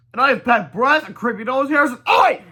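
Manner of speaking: high, loud disgusted yell. Acted. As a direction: yell in horror. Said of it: "yelled the guy".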